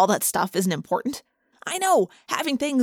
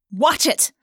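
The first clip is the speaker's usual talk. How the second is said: a quick, raised snap of warning; sharp snap; 6 semitones higher, 4 dB louder than usual. A narrator's reading. Rachel snapping at a girl who bumped her. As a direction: bite off the words, irritated. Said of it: "Rachel snapped".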